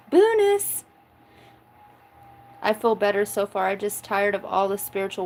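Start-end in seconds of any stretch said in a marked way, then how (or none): 0.1-0.8 Bonus squealed high and loud as a punchline; squeaky bonus. Shown as a demonstration. Repeating her pun for the chat, squealed high and loud. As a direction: pop the word Bonus up high like a joke squeak. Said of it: "what I call a bonus".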